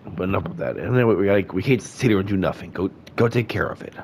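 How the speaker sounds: ordinary talk, nothing marked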